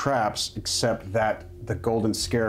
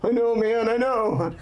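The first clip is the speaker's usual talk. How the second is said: high, hammed-up panicky dude; mock-terrified slacker; freaking out; 9 semitones higher than usual. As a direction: agree in a comic fluster, pitched up. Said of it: "He's freaking out".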